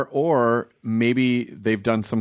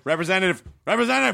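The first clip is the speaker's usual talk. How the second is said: repeating into phone menu; 7 semitones higher, much faster than usual. Retold or imitated. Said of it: "You're just like".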